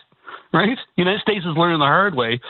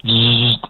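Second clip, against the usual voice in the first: a sharp, loud zzz like a zapper; a zapped-to-silence noise; zapping buzz; 5 semitones lower, 5 dB louder than usual. Retold or imitated. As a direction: make a quick zapping buzz. Silencing noise in speech about repression.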